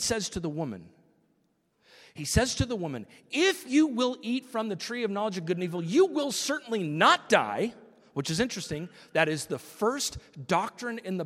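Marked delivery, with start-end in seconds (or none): 3.3-7.7 high, loud tempting serpent; a sly tempter raising his voice to sell the lie. Acted. Preacher voicing the serpent to Eve, high and loud.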